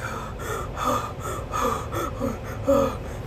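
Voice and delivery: long run of breathy gasps; acted-out hyperventilating; panicked panting. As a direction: pant shakily, catching breath. Acts out relief panting as ride slows.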